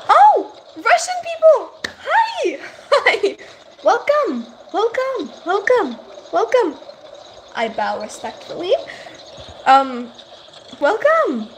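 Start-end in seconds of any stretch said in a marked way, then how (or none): 0.1-2.6 high, loud, thrilled greeting; excited shout. Real, not acted. Greets Russian viewers excitedly. 2.9-6.8 high, bright repeated welcome; excited welcome. Real, not acted. Welcoming viewers in chat. 10.8-11.5 excited welcome. Real, not acted. Welcoming viewers.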